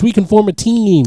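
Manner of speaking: high eager rallying voice; Deadpool pep talk. Retold or imitated. Friend retelling Deadpool rallying the team, high and eager. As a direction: pump the team up, pitched well up.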